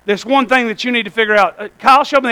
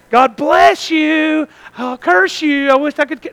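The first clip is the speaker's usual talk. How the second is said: slow, drawn-out fake blessing; mock sweetness; 5 semitones higher, slower than usual. Preacher playing two-faced church people blessing, slow mock sweetness. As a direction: stretch the blessing sweetly, slip the curse underneath.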